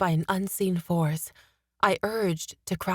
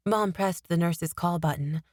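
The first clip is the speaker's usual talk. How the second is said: plain reading voice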